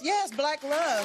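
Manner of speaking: drawn out, proud, savouring each word; slow proud declaration. Real, not acted. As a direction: savour it slowly like a proud boast. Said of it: "proud slow aside".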